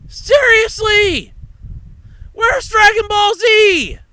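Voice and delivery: high, loud indignant outburst, stretching Dragon Ball Z; outraged disbelief. Real, not acted. Host ranting that Dragon Ball Z is missing, high and indignant. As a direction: demand an answer from the list makers.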